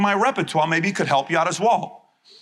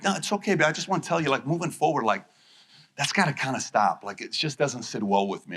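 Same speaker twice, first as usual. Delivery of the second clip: softer, calm, sincere complaint to a partner; gentle honest talk; 5 semitones lower, 5 dB quieter than usual. Acted. Husband telling his wife calmly what hurt him, soft and sincere. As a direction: tell her kindly but firmly, dropping soft.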